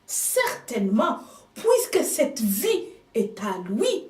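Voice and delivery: ordinary delivery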